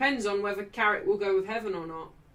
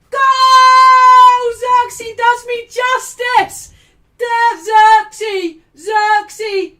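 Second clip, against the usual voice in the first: screaming the goal, much higher and far louder; ecstatic goal shout; 13 semitones higher, 18 dB louder, much slower than usual. Real, not acted. Fan celebrating a goal on his stream, ecstatic roaring. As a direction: bellow it like the ball just hit the net.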